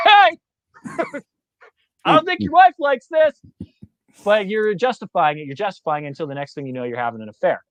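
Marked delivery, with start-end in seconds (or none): 0.1-3.4 very high, hammed-up inner voice with a laugh; conscience's warning. The inner voice warning the man, high and hammed up. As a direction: play the squeaky nagging conscience.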